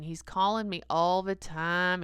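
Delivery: ordinary talk, nothing marked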